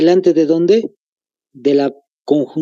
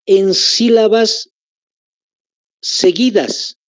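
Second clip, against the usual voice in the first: slow dictation; 4 semitones higher, slower than usual. Shown as a demonstration. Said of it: "Anótense".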